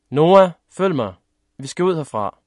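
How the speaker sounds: loud, high opening shout, then easing; urgent call. A narrator's reading. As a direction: order your child out of the fire. Father urging child out of fire.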